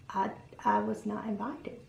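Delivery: small, hushed, halting reply; awkward quiet admission. Retold or imitated. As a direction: admit it softly, stumbling at the start.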